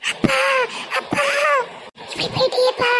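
high-pitched wailing cry for father; mock wailing. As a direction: wail it up high, like a daughter at a funeral. Daughter wails for dead father. (உயர்ந்த குரலில் அப்பாவுக்காக அழும் ஒப்பாரி)

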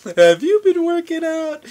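high, loud, slow mock compliment. Guy teasing a stranger at the mall, high slow mock voice. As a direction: stretch it out way up high like a fake fan. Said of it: "I'll be like".

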